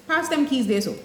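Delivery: relaxed Caribbean Creole, tossed off to a friend; casual Caribbean Creole. Shown as a demonstration. Teacher to her girlfriend, casual Caribbean Creole. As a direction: say it in easy Caribbean dialect, informal. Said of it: "if that were my good girlfriend, I'm gonna say".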